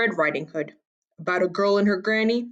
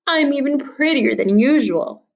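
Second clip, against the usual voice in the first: put-on vain girl; 7 semitones higher, 3 dB louder than usual. A narrator's reading. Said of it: "she said".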